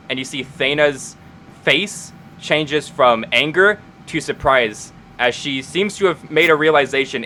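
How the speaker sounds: normal talking voice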